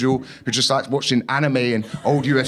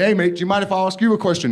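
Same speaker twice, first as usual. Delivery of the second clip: higher, fast, bright mock-casual; own quoted question; 10 semitones higher, faster than usual. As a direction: rush the question lightly. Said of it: "I went".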